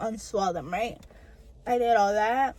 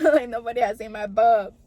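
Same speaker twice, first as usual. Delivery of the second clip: laughing through the words, a touch louder; 7 dB louder than usual. Real, not acted. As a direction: giggle it out, embarrassed. Embarrassed exam retold with laughter.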